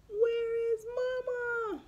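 high, slow whimpering wail; whimpering cry. Acted. Baby llama whimpering for his missing mama. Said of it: "whimpers softly for his".

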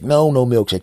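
high, loud protest; frantic refusal. Pretend. Man cancelling his milkshake, frantic refusal. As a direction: cut in loudly and urgently.

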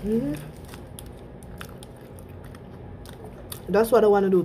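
talking while chewing; muffled by food and hand; mouth full. Real, not acted. Seen 4: Holds sandwich; hand over full mouth, cheeks chewing while talking.

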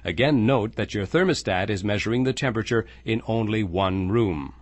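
ordinary talk

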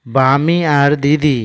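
slow, deliberate reading of বামি আর দিদি; slow clear reading. Shown as a demonstration. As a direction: read বামি আর দিদি slowly, word by word. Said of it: "এবার আমরা বানান ছাড়া পড়ার চেষ্টা করব". (ধীরে স্পষ্ট করে পড়া)

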